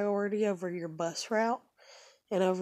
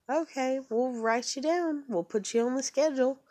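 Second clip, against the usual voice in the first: imitating a breezy phone scheduler in a higher voice; higher, chirpy customer-service voice, slowing on we'll write you down; mock scheduler; 7 semitones higher than usual.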